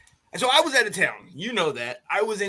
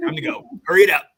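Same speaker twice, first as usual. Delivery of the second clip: mock urgent; 3 semitones higher, 8 dB louder, much faster than usual. Joking along with the story.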